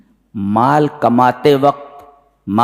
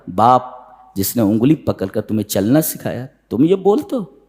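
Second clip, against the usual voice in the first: usual speaking voice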